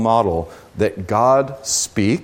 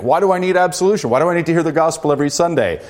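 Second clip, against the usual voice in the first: mock questioning; 6 semitones higher, much faster than usual. Voicing a doubting churchgoer's questions.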